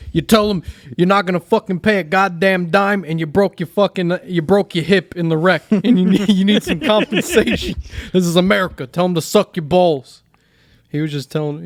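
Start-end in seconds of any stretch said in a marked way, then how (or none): 0.1-10.2 higher, louder mock tirade; imitating Joey's rant. Joey loudly coaching Lee on the car wreck claim. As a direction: bark orders like a fired-up uncle. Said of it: "And Joe's like".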